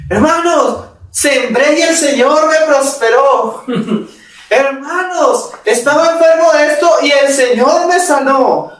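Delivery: testimonio triunfal en burla, mucho más agudo. Retold or imitated. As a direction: se burla del testimonio de prosperidad en la tele. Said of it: "cuando van a contar los testimonios". (imitación burlona de un testimonio televisivo)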